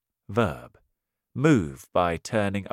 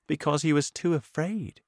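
usual speaking voice

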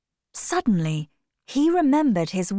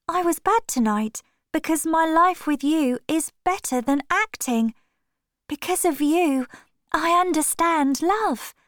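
put-on high, light girlish voice, earnest; a young actress pouring out her heart; Sybil in love; 9 semitones higher than usual. Sybil explaining to Dorian why she acted badly, high and tender.

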